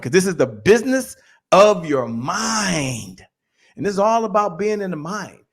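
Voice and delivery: normal talking voice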